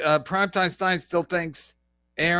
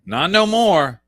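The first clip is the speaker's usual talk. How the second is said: ordinary talk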